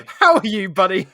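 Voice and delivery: hammed-up high, loud hello with a laugh; exaggerated greeting. Playful greeting to his co-host.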